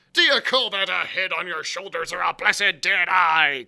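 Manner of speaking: very loud, scolding roar; crying out. A narrator's reading. Long John berating the forgetful sailor, crying out. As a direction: bawl him out at full voice. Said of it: "cried Long John".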